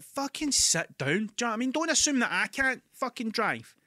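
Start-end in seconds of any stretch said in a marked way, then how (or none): 0.0-1.1 higher, slow, fed-up snap; irritated snap. Retold or imitated. To the woman watching him park, a slow higher irritated snap. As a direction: bite it out at her, exasperated. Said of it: "I was like".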